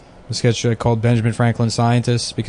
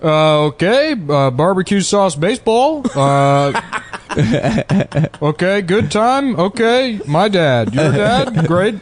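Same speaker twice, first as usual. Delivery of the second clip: halting nonsense phrases with higher swings; stop-start mock speech, each phrase pitched up; Obama impression by Chinese comic; 5 semitones higher, slower than usual. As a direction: imitate a non-English speaker doing presidential cadence. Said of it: "he does an Obama impression".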